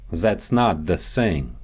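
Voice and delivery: Russian accent, "That's" said as "zats" and "thing" as "sing"; Russian accent, Z and S for the TH sounds. Shown as a demonstration. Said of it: "The T H sound".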